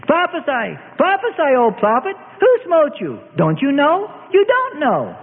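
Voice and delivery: high, jeering taunts; mocking. A narrator's reading. Mob circling the beaten prisoner, mocking him. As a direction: jeer at him in short high cries. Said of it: "laughing and mocking in a moving circle".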